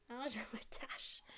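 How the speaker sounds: hushed whisper, as if hiding; breathy hiding whisper. Shown as a demonstration. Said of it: "la personne se cache".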